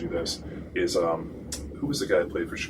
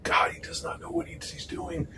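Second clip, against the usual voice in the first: high, whispery mock prayer; hushed plea to God, acted out; imitated muttered prayer; 12 semitones higher, 4 dB quieter than usual. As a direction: imitate someone praying under their breath. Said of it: "he starts, uh, praying for me".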